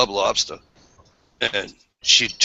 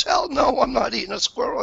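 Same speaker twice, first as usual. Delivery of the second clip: very high-pitched mimicked refusal; mock outraged girlfriend. Man mimicking his girlfriend refusing to eat squirrel, mock outrage. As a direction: put on an indignant girlfriend's high voice.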